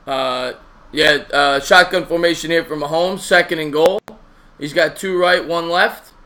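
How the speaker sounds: loud, energetic sports commentary; play-by-play call. Acted.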